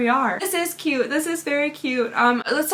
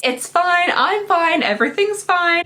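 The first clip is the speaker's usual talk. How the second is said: forced cheer; 6 semitones higher, 5 dB louder, slower than usual. Retold or imitated. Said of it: "I say this all the time".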